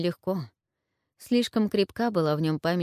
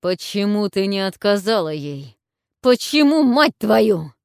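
yelling; 3 semitones higher, 8 dB louder than usual. A narrator's reading. Warlord yells at the witch, shaking her, enraged. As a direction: bellow it in rage. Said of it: "заорал он".

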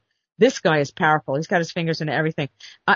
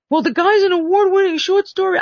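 mocking voice; 13 semitones higher, 4 dB louder than usual. Quoting the manager's excuse.

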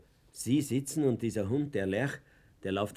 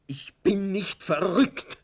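louder, slow and emphatic; insistent outburst; 6 semitones higher, 8 dB louder, slower than usual. Acted. Fuchs insists to the director he is not mad, slow and loud. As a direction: insist with each word.

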